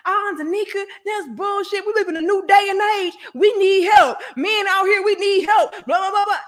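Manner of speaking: high, fast, shouted protest; mock complaining commenter. Male commenter arguing with Danica, high shouted whine. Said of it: "they was like".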